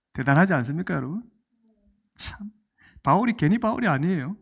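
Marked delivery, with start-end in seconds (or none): 2.2-2.5 whisper. Real, not acted. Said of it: "awed whisper".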